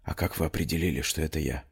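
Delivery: very soft, hushed voice; whispered reply. A narrator's reading. Girl answers Amir in the dark tunnel, whispering. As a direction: barely voice it, as if whispering. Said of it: "и шепнула".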